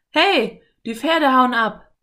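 high, loud shout of alarm. A narrator's reading. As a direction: yell a warning to the other thieves. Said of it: "rief einer der Pferdediebe".

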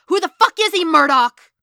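high, very loud yelling; enraged full-voiced interrogation; furious shout. A narrator's reading. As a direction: explode at him. Stick explodes at Matt.

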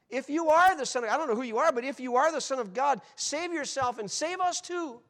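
loud, high, taunting challenge; dying thief jeering at Jesus; mocking thief. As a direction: sneer it up at him, loud.

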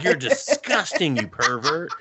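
high, slow scolding through laughter; laughing mock scold. [name] calling her friend a pervert, a laughing mock scold. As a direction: play the outrage but crack up.

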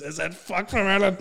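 loud, high mock-incredulous comedian; Kevin Bridges. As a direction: imitate a stage comic scoffing loudly. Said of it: "He goes".

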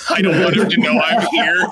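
high-pitched, talking through laughter; laughing. Real, not acted. Player changes the roll to hide, laughing. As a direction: crack up while explaining the move.

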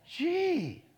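a sharp squeaky high gee; mock startled yelp, very high. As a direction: jump back in mock shock. Reaction to the lady's hostility.

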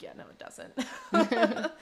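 laughing agreement. Retold or imitated. Bob agreeing, breaking into laughter.

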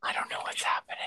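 hushed real whisper. Real, not acted. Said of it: "I have to whisper 'cause he can hear me".

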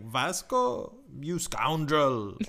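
overdone mock indignation, pitched high; mock melodramatic yell. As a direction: imitate an unconvincing actress crying out, slowly. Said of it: "she would just yell that".

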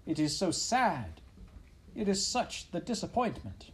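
sighing French mother. A narrator's reading. French mother mouse sighing over her one baby, high French lament. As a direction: sigh it out dramatically in French accent. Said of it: "She sighed".

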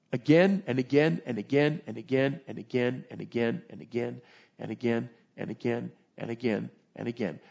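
steady, fading chant of again; rhythmic repetition. Real, not acted. Repetition of hand squeezing.

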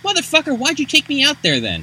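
hammed-up furious kid; mock outrage. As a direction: yell at the old man in disbelief. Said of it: "It's like".

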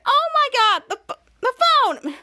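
high, loud startled cry; sudden alarm. Retold or imitated. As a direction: react like the phone just exploded. Phone suddenly rings.

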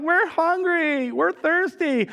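high, drawn-out whine; whiny complaining kids. Retold or imitated. Israelites complaining to God in the wilderness, slow high whine. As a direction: whine like bored kids on a road trip.

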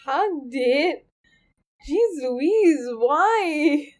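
drawn-out, swooping disbelief; incredulous. Real, not acted. Listener reacting to mother's advice to hug a ghost, incredulous.

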